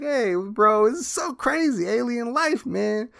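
very high hammed-up buddy voice; Eddie voice. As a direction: hype it up like a goofy pal. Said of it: "he's like".

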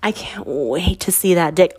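low, raspy seductive murmur; deep raspy voice. Retold or imitated. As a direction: imitate a sultry voice memo, husky and teasing. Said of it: "in a deep or, like, in a, like, raspy voice".

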